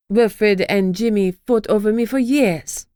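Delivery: put-on slow, proud French-accented spite; spat, French accent. Ex-wife boasting to Lucy, slow French accent. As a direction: drag it out with French pride. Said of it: "she spat".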